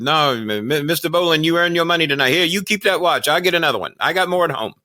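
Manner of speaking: high-pitched mock Japanese accent; hammed-up Japanese-accented impression; fake Japanese accent. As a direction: imitate a generous wrestler with a put-on Japanese accent. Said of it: "fake Japanese accent".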